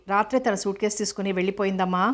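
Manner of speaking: usual speaking voice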